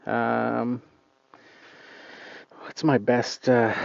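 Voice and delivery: usual speaking voice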